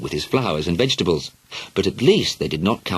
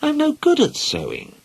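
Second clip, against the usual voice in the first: serious. Acted. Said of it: "said Helen seriously".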